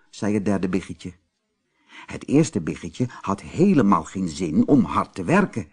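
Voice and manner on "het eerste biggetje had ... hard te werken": usual speaking voice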